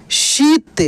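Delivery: शीत with the ī held long; drawn-out long ī. Shown as a demonstration. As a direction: stretch the ī in शीत. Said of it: "इसका उच्चारण खींच कर होता है". (शीत की ई खींच कर)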